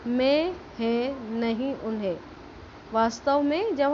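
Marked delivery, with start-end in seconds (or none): 0.1-2.2 मैं, है, नहीं, उन्हें said slowly, each one nasalised; slow nasal demo. Shown as a demonstration. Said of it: "तो ये उच्चारण ऐसा होता है". (धीरे धीरे अनुनासिक उच्चारण)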